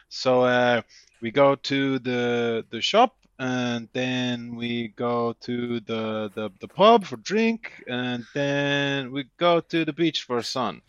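put-on slow, broken English in a vague foreign accent; mock foreign accent. Friend talking to his foreign mate, in a slow, hammed-up foreign accent. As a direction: drag each word out like a tourist talking slowly to a local. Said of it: "he puts on an accent like that".

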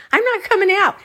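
high-pitched repeated protest; adamant refusal. Retold or imitated. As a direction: insist in a high voice. Retold refusal in restroom quarrel.

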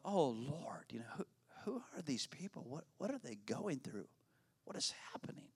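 soft whispered wondering to God; hushed prayer. Retold or imitated. Pastor silently praying for the elderly couple, whispered. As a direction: breathe it out, deeply moved.

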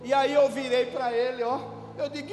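normal talking voice

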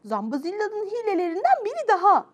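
high, understated storybook anger; mildly indignant exclamation; angry. Acted. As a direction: complain crossly at a normal volume. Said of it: "dedim öfkeyle".

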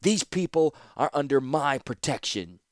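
a firm shout down the stairs. A narrator's reading. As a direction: claim them loudly as hers. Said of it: "stepped forward and shouted".

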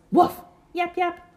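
high yappy barks; yappy dog voice. Acted. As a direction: yip like a tiny dog. Dogs barking.

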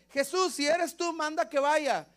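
high-pitched plea across a distance; calling out. Acted. Disciple calls to Jesus on the water, calling out. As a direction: call out high to someone far off.

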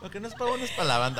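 gleeful laughing echo of "Lo que no es pa' uno es pa' la banda"; laughing repeat. Shown as a demonstration. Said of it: "yo dije".

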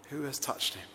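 ordinary talk

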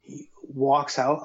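ordinary talk, nothing marked

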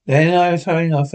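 ordinary delivery, nothing marked

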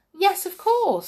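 a small excited brownie; huffy little helper elf. Acted. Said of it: "said the little fellow".

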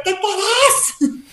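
high, drawn-out mock shout; retold exasperated yell. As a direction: act out yelling at the dog, dragging it out. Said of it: "hasta que le grité".

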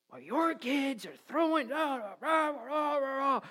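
high-pitched imitated rant without real volume; hammed-up cussing tirade; mock angry yelling. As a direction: imitate a neighbor ranting into gibberish. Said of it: "he starts yelling and cussing at me".

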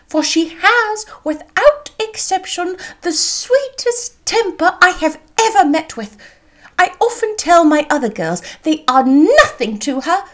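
high-pitched gushing matron with swooping emphasis; profuse mother. A narrator's reading. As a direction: flatter the hosts effusively and at length. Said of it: "was profuse in her acknowledgments".

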